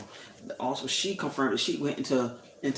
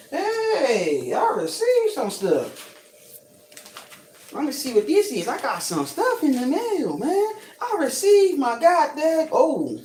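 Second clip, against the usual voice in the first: excited; 12 semitones higher, 4 dB louder, slower than usual. Real, not acted. Excited about mail package.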